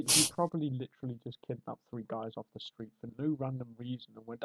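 ordinary talk

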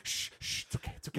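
soft unvoiced shushing; shushing whisper. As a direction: hush it like comforting a child. Soothing the beaten Nintendo.

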